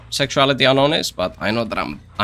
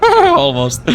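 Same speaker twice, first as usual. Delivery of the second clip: squeaky high exclaim; 5 dB louder than usual. Real, not acted. Squeaky reaction to miss.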